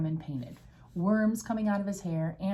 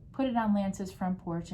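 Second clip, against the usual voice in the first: plain reading voice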